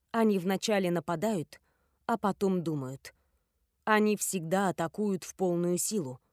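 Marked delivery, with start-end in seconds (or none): none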